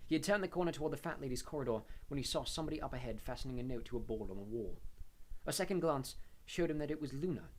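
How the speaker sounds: usual speaking voice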